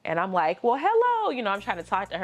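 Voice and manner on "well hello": high, loud sing-song hello; bright greeting. Retold or imitated. Daughter cheerfully greeting her grumpy mom, high sing-song.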